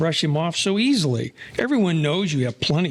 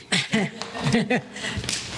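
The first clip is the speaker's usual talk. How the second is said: soft unvoiced laugh; breathy chuckle; 5 dB quieter than usual. [name] laughs at his own jab about the Dutchman, softly. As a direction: huff out a quiet laugh at the joke.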